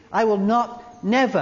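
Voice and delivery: not and never punched; stress on not never. Shown as a demonstration. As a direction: hammer the double negative. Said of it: "double negative".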